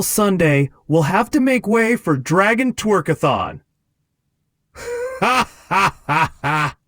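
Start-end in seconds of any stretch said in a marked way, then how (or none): 4.7-6.8 laughing. Real, not acted. Gleeful over Dragon Twerkathon.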